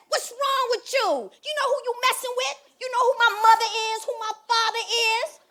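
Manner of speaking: loud, high, rushing threats; fake bravado. Scared woman challenging a kid with a cleaver, blustering. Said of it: "I'm scared, right? But I know you can't show fear".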